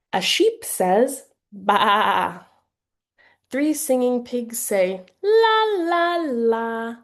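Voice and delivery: slow, sing-song read-aloud; drawn-out, playful picture-book voice; storybook animal sounds. Shown as a demonstration. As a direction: play the animals for a little one. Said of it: "an example of how you might read this book".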